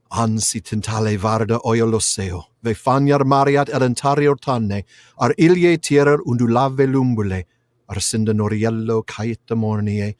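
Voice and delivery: measured incantatory Elvish verse; poem recitation. A narrator's reading. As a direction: intone the lament phrase by phrase with pauses. Recites Elvish lament in Quenya.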